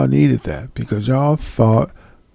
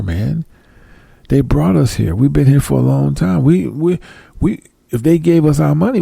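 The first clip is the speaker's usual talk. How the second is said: usual speaking voice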